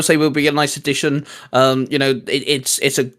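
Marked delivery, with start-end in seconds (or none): none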